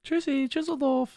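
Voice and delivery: high, slow cutesy goodbye; tiny sweet voice, drawn out. Shown as a demonstration. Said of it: "Like a little cutesy voice".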